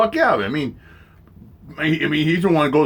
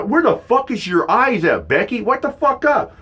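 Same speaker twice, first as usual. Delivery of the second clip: exasperated retort; 10 semitones higher than usual. Retold or imitated. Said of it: "I'm like".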